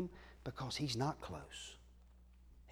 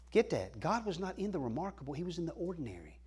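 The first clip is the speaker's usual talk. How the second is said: ordinary talk, nothing marked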